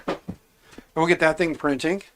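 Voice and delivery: usual speaking voice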